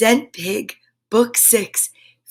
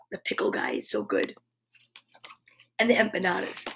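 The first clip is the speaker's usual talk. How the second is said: quick whispered aside; 4 semitones lower, 10 dB quieter, faster than usual. Real, not acted. Aside about local market.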